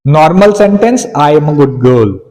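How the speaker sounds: normal talking voice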